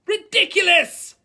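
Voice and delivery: slow, high, very loud bellow; pupil's all-out shouted spell. A narrator's reading. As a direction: bellow the incantation, stretching it out. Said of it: "bellowed Ron".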